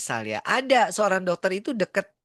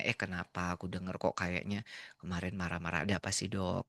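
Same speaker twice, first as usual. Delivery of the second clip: much softer, lower, kindly asking; gentle asking; 10 semitones lower, 9 dB quieter than usual. Shown as a demonstration. Speaker gently asks the angry doctor, soft and calm. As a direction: ask softly, no blame at all. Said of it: "Aku mestinya nanya".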